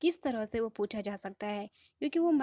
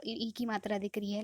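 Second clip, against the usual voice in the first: ordinary talk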